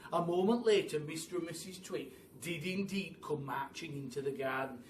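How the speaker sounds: usual speaking voice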